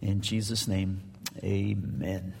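ordinary talk, nothing marked

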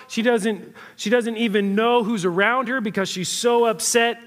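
usual speaking voice

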